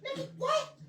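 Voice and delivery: very high, incredulous squeak; grandmother's disbelief. Retold or imitated. Grandmother to boy who won't come, high-pitched disbelief. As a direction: mimic an exasperated granny up high. Grandmother's exasperated reaction, acted.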